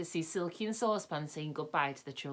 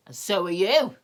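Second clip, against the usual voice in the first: louder, sudden retort; sudden accusation; 3 semitones higher, 10 dB louder than usual. A narrator's reading. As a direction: snap it back accusingly. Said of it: "said what's-his-name suddenly".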